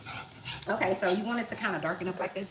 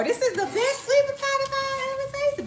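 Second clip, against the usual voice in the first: much higher gushing voice; mocking gushing coworker; 4 dB louder than usual. Mimicking husband's coworkers raving in a high voice. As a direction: rave like a convert.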